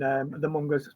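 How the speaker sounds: normal talking voice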